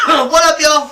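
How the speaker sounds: loud, high shouted hello to the crowd; loud greeting. Real, not acted. Co-host bursting in to greet listeners, loud shout. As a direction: belt out What up, y'all like a hype man. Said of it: "No, no need".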